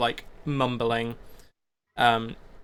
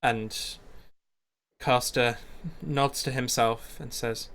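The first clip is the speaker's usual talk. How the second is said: normal talking voice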